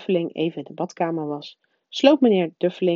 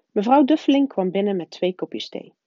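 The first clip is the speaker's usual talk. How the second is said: usual speaking voice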